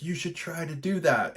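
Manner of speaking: lightly voiced high-pitched urging; people egging on. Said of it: "And they're like".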